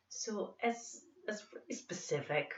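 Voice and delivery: sing-songy Northern Irish lilt; Northern Irish accent, lively and musical; thick Irish accent. Shown as a demonstration. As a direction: lean into a thick Northern Irish accent, melody rising and falling. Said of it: "a pretty thick Irish accent".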